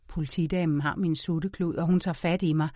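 plain reading voice

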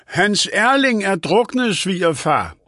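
high, drawn-out call; mildt råb. A narrator's reading. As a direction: call it out slowly to someone who won't listen. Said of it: "råbte Elise". (råbende, højt toneleje)

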